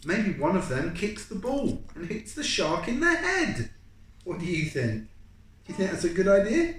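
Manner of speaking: put-on high, loud keen boy calling out; eager classmate bursting to answer, high and loud; eager child. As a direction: bounce in, hand waving. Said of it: "Jack waves his hand".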